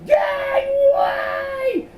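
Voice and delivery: very high, drawn-out scream; panicked shout. A narrator's reading. Miles darts for the bedroom door, shrieking shout. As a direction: scream it long and high while bolting. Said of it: "with a shout".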